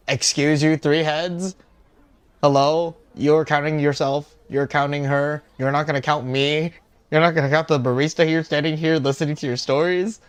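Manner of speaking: loud, offended ranting; loud, exasperated complaint; mock indignation. Real, not acted. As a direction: protest like you've been snubbed. Streamer mock-indignant at being left out.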